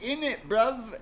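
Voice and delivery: high-pitched mock British slang; fake British accent. Stranger's buddy faking British at the bar, mock British accent. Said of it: "stuck with the fake accent".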